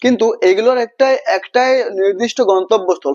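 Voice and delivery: normal talking voice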